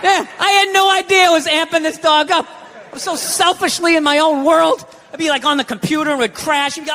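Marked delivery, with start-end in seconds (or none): none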